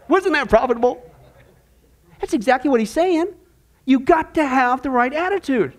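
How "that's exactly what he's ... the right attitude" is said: usual speaking voice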